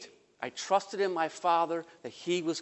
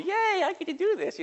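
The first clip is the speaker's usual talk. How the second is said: put-on high, excited little girl voice; happy little sister; 13 semitones higher than usual. Little sister thrilled on the raft, squealing a high excited yay. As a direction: squeal it like a delighted small child. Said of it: "she was all happy".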